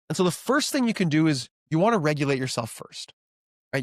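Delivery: normal talking voice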